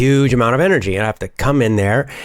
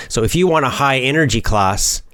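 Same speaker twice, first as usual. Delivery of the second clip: normal talking voice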